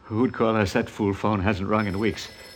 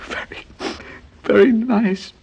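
voice breaking; 13 semitones higher than usual. Acted. Old man breaking down, voice failing.

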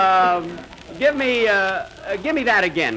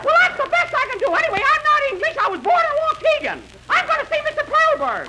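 high mock-woman voice, protesting; indignant falsetto; 13 semitones higher than usual. Actor defending his accent to director, indignant falsetto. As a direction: argue back without dropping the falsetto.

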